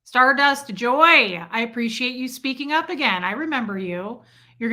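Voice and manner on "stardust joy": loud, drawn-out greeting; excited roll-call shout. Real, not acted. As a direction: call the name out loud, stretching it. Calling out viewers in roll call.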